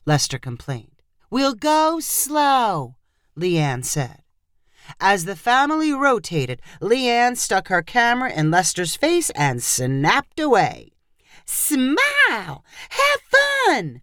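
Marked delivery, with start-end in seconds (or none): 1.3-2.9 soothing reassurance. A narrator's reading. Photographer reassuring Lester. 11.4-14.0 high, bossy cheer, no room to refuse; bright demand. A narrator's reading. Photographer barking cheer at family: high bossy demand. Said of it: "she demanded".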